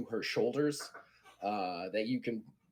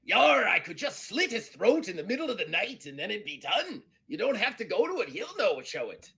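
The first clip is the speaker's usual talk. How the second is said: loud, high-pitched pirate voice; hammed-up pirate, pitched way up and swooping; Eddie Teach; 9 semitones higher, 4 dB louder than usual. Pirate casually proposing murder to a crewmate, hammed-up high pirate voice. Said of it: "And he's like".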